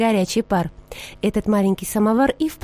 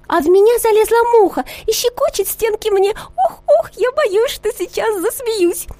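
much higher squeaky sugar-bowl voice, ticklish; squealing sugar bowl; 11 semitones higher, 3 dB louder than usual. A narrator's reading. Sugar bowl cries out about a fly tickling her. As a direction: squeal it high, about to giggle. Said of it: "закричала".

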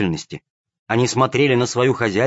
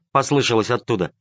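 ordinary delivery

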